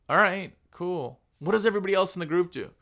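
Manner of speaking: normal talking voice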